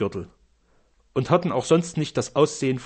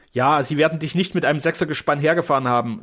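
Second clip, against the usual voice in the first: ordinary delivery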